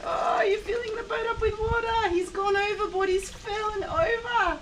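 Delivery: high, bright mock dismay; excited high mock alarm. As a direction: act alarmed for the toddler. Boat capsizing in play.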